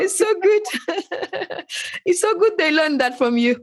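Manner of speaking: high and laughing through the words; laughing delight. Real, not acted. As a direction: gush with real laughter.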